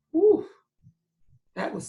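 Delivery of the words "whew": relieved whew. Acted. Relief after near fall.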